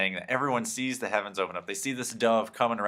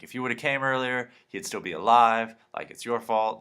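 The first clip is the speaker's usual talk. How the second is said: mock upset sisters; 4 semitones higher, 6 dB louder than usual. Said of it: "upset at Jesus".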